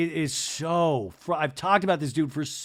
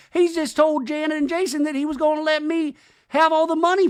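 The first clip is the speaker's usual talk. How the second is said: higher, louder imitation of a complaining wife; hammed-up aggrieved wife; mock whiny Brittany; 12 semitones higher, 6 dB louder than usual. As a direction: mimic her upset rant, pitched up.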